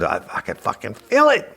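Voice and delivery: rising into a high yell; high, agonized outburst, acted out; re-enacted pained yell. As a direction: build to a pained shout on the last words. Re-enacts screaming answer in pain.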